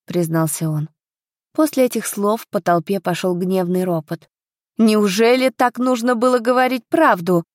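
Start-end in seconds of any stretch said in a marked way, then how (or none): 4.8-7.4 indignant question, pitched higher; mildly exasperated outburst; flaring up. A narrator's reading. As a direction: reproach with a touch of outrage. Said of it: "взвилась бывшая подруга Тины".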